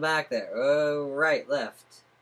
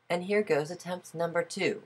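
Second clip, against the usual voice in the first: higher-pitched announcing voice; second test voice; 6 semitones higher than usual. Shown as a demonstration. Tester announcing a second voice attempt, higher-pitched test voice. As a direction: lift the pitch for a trial voice. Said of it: "Do I sound all right in this voice".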